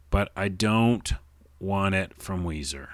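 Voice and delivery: ordinary talk, nothing marked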